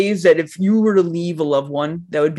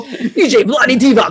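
high, drawn-out mock DJ announcement; DJ hype; 6 semitones higher, 3 dB louder than usual. Riffing on a name as a DJ streamer, mock hype. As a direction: announce it like a hyped-up DJ. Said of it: "a D J streamer as well?".

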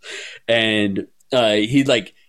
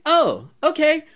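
very high, bubbly fake innocence; ditzy glee. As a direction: sweetly invite trouble. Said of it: "acting super ditzy".

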